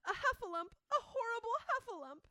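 high, loud panicked cries for help; panicked cry. Retold or imitated. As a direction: cry out in terror like a tiny pig. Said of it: "cried Piglet".

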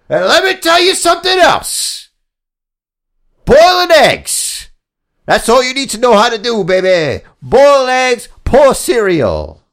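strut through a promo; wrestling promo. Acted. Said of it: "like I'm doing a wrestling promo".

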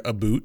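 "a boot", the caricature Canadian vowel in about; mocked Canadian "boot". Shown as a demonstration. Cohost quotes the disputed word for about, caricature Canadian vowel. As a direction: say "boot" with a long oo. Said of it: "we don't think that they say a boot".

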